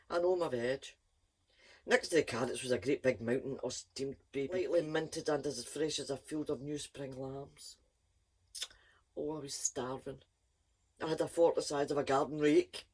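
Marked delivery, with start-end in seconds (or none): none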